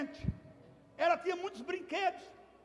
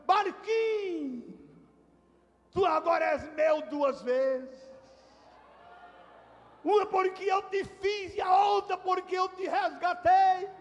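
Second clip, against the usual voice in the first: loud, slow, emotional cry; shouting out loud; 4 semitones higher, 5 dB louder, slower than usual. Retold or imitated. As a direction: call out to the boat, tearful and overjoyed. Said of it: "ela gritava alto dentro da loja". (grito alto e emocionado, bem lento)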